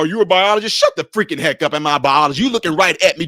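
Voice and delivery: ordinary talk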